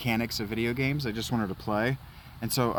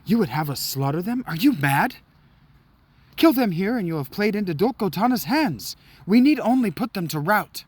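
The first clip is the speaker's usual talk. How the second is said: higher, raised objection; indignant knight; 9 semitones higher, 6 dB louder than usual. Acted. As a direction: argue back in alarm. Knight protests in cutscene.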